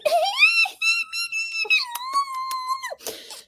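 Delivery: very high, long squealing shriek; imitated bird screech. Owner mimicking Brando when picked up, screeching. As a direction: screech like a parakeet being picked up. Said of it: "and she's like".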